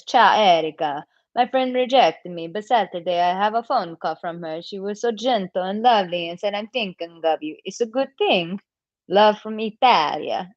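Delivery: playful put-on Italian accent. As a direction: read it out in a hammed-up Italian accent. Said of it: "did you like my Italian accent".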